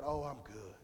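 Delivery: low, quiet, deflated "Oh, I'm good"; mimicking his buddy's limp, quiet answer; Kyle's flat reply. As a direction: mumble it low and soft, like a friend who doesn't care. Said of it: "you always say it like that".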